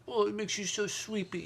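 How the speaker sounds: put-on high, soft cooing baby talk. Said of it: "talk in baby voice".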